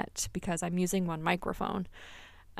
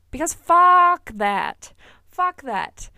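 higher, louder and slow, spitting the words out; defiant outburst; 9 semitones higher, 10 dB louder, much slower than usual. Real, not acted. Telling listeners off about weight comments, loud and defiant. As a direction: slam the door on the idea.

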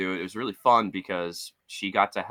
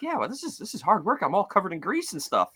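higher, mock-whiny complaint; wife complaining; 7 semitones higher than usual. Wife complaining about hard work, much higher and whiny. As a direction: whine about the grease. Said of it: "now she's like".

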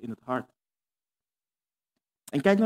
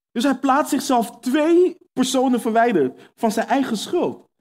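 fervent preaching; 4 semitones higher than usual. Acted. To the congregation about Adam's excuses, fervent preaching.

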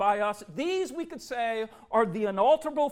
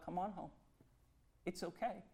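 much softer, tender fatherly reassurance; Father's gentle call; 13 dB quieter, faster than usual. Acted. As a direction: call a lost child home gently. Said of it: "the Father continues to call out".

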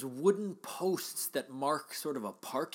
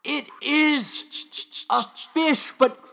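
rising from eager to frantic, higher and louder; building excited commentary; 9 semitones higher, 6 dB louder than usual. Acted. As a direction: call it like a sports announcer losing it. Excited play-by-play of the run.

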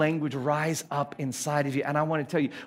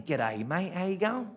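Australian accent; 6 dB quieter than usual. Shown as a demonstration. Preacher showing how he sounds weird, Australian accent. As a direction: greet a mate in broad Australian. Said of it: "When I talk in Australian".

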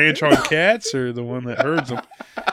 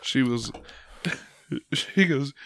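ordinary talk

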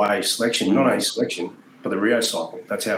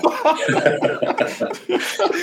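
a high airy wheeze of laughter; breathless laugh. Real, not acted. Remembering the chaotic haka, breathless airy laugh. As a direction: let the laugh escape on the breath.

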